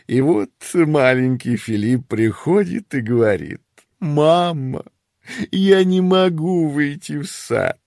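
amused mother dragon, giggling through; laughing storyteller mother. Acted. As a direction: tell it like a proud giggling mom. Mother dragon tells funny story. (весёлая рассказчица-мать)

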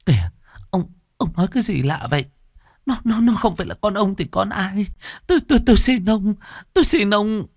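high, stammering wail; frantic pleading. Acted. Wife anxiously denies husband's accusation, frantic pleading. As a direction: plead desperately, voice pitched right up.

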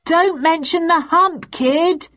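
high, urgent heckle; audience yell. A narrator's reading. Audience warning the young Duke, yelling. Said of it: "The audience yelled out".

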